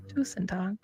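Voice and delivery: soft unvoiced tusen takk; whispering.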